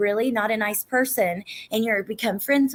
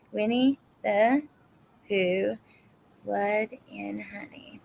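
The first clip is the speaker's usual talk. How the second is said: slow, word by word, trailing softer; writing it down; 4 dB quieter, slower than usual. Real, not acted. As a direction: spell it out to yourself while writing. I'm writing all these down.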